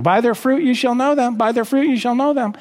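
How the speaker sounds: mocking misuse. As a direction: parody people who fling the verse around out of context. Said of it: "when people love to use that out of context".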